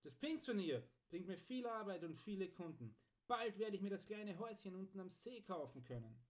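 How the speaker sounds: a hint of a pleased, chuckling smith; cheerful smith. A narrator's reading. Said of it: "lachte der Schmied".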